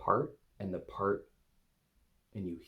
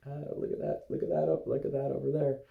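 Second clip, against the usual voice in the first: higher, wary voice pointing things out; anxious part's voice; 6 semitones higher than usual. Retold or imitated. The anxiety part eyeing the camera, higher and wary. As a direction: play the nervous part spotting the camera. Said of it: "sort of like".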